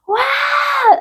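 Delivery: high, sustained mock scream; long high wail, not at full volume; imitated scream. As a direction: re-enact a sudden cry. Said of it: "así como que grito".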